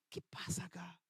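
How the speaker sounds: unvoiced puzzled whisper; preacher whispering his own bewildered thought. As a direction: breathe it under your breath, confused. Aside to self, voiced whisper.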